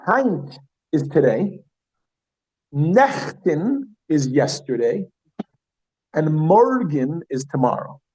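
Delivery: slow, spaced teacher delivery; slow vocabulary drill; slow vocab drill. Shown as a demonstration. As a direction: lay each word out slowly. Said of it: "teacher slow vocab list".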